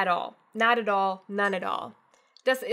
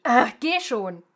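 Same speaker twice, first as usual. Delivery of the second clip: louder, irritated push; angry; 7 dB louder, slower than usual. Shown as a demonstration. Teacher shows an angry shove to go. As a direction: snap it at them impatiently. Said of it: "or really angrily".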